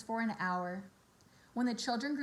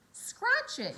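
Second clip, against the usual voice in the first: teasing yell; 12 semitones higher, 3 dB louder than usual. A narrator's reading. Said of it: "yelled Avery".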